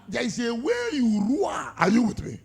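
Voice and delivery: roaring pulpit shout; full-force preaching. Acted. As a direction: cry it out to the congregation.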